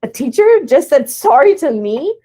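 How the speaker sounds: put-on high, loud astonished kid; kid's stunned disbelief. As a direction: imitate a student floored by an apology. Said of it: "his face was like".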